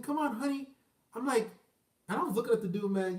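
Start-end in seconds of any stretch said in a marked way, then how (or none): 0.0-0.7 soft, higher, meek coaxing; mock a submissive husband, quietly; imitated servile husband. Servant-like husband calling his wife along, meek mock voice. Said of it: "they, like, servants".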